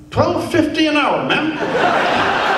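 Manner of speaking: high-pitched, blunt retort; Buddy the biscuit worker. Retold or imitated. Biscuit worker answering the Queen, blunt and high-pitched. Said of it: "Buddy looked back down at her and he said".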